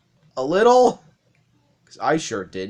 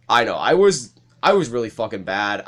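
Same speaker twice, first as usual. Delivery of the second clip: ordinary talk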